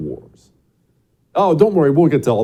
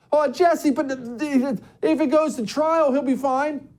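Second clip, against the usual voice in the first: much higher voice of a protesting caller; mock objecting listener. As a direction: imitate a naive listener pleading the other side, high-pitched.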